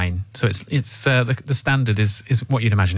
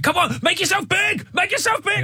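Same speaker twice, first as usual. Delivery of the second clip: high, strained mock shout; aggressive parent yell. Goalkeeper's parent urging on a nine-year-old, aggressive high shouting. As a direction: holler urgent orders from the touchline. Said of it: "like, aggressive they get".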